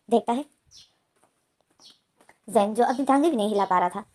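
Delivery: ordinary delivery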